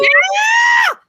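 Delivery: a high, loud "yeah!"; excited yell. Real, not acted. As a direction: shout it out in delight. Excited exclamation.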